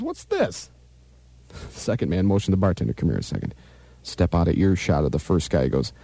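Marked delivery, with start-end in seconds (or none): 0.0-0.7 high, puzzled bartender squeak; a baffled barman, pitched way up. Acted. Joke teller voicing the bartender eyeing bottle caps, high and puzzled. 2.9-5.6 hushed customer. Acted. Second man voiced in a joke.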